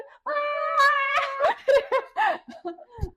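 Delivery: loud high wheezing laugh; crying with laughter. Real, not acted. Guest losing it over the nail pun, crying with laughter. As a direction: laugh so hard it turns to tears.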